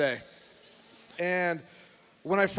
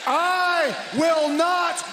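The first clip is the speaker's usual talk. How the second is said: fired-up shouting; 8 semitones higher, slower than usual. Real, not acted. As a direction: roar it to the arena. Fired-up wrestling promo.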